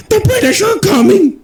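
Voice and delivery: high, frantic alarm call; panicked crier. Acted. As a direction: shriek the warning like a crier. Quoting the panicked town crier.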